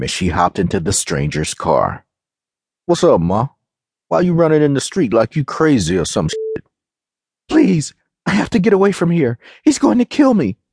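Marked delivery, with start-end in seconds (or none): 2.8-6.6 lightly voiced guy behind the wheel; a touch of casual, puzzled street talk; street-slang driver. A narrator's reading. Male driver hails frantic stranger. 7.5-10.6 high, panicked begging; fearful voice pitched way up; hysterical pleading. A narrator's reading. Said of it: "She was behaving hysterically".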